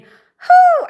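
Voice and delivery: high loud "whoo" cheer; excited whoop. Real, not acted. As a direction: let out a big happy whoop. Cheering in live chat.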